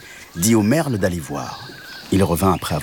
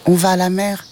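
high-pitched cat voice; small, high kitten answer; little kitten; 11 semitones higher than usual. Acted. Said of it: "répondit le chat".

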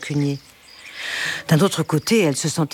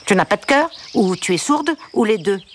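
irritated nasal duck; 4 semitones higher than usual. A narrator's reading. Weeping duck scolding Olga, irritated nasal voice. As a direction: nag with a touch of nasal annoyance. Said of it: "le canard s'énerva".

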